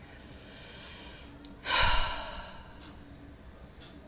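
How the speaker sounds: deep breath in, big sigh out; long audible breath. Shown as a demonstration. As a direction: take a deep breath and release it. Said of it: "You take a deep breath".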